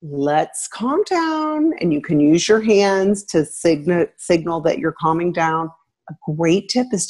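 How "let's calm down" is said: much higher, very slow and soothing; soothing calm-down. Shown as a demonstration. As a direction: draw it out gently to settle a teen. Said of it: "you can just tell them".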